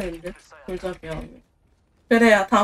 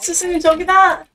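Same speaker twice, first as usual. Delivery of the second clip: excited call-out; 8 semitones higher, 5 dB louder than usual. Real, not acted. Spotting an enemy mid-fight.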